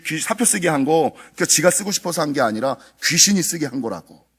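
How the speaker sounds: normal talking voice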